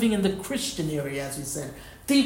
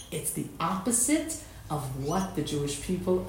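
retold devastation; 2 semitones lower, 7 dB quieter than usual. Rabbi quoting a devastated commentator's lament to his listeners, subdued devastation. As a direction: quote him with a touch of heartbreak. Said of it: "he's, like, he's really devastated".

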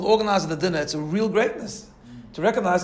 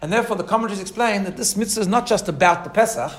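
ordinary delivery

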